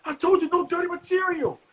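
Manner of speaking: high, strained imitation of the owner; angry club owner. Club owner scolding the comic after the set, high outraged voice. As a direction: squeal it out like an outraged boss.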